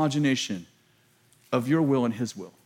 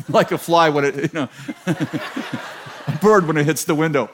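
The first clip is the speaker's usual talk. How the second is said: laughing. Real, not acted. Laughing while explaining comparison.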